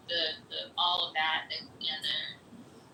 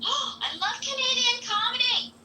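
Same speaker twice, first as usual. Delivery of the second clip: gasp, then high, louder, slow delight; thrilled at the news; excited gasp; 5 dB louder than usual. Real, not acted. Hearing about a Canadian comedy show, real excited gasp.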